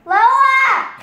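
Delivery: high, loud yell of a name; calling out. Real, not acted. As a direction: holler after someone who left. Calling for someone who left.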